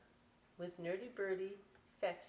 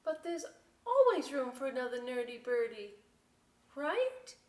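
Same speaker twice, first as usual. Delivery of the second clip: high, louder, quick hopeful plea; pleading nerdy birdie; 5 semitones higher, 6 dB louder than usual. Acted. Nerdy Birdie reminding his friends, pitched up and louder.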